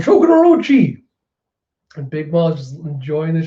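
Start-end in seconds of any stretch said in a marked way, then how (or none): 0.0-1.0 high, loud shout of alarm; anguished cry. Retold or imitated. Fukuroji crying out for his beheaded master, high and loud. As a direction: cry out for your fallen lord. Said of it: "Fukuroji going".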